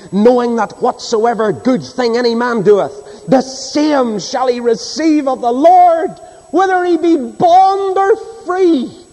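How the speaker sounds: scripture cried out high and slow; preaching at full force. Acted. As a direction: build to a soaring climax on the verse. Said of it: "Read it again".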